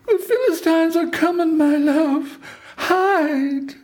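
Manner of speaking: very high, held falsetto warning call; Delilah's cry. A narrator's reading. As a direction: cry out to wake him as Delilah, far above your own voice. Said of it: "and then she cried".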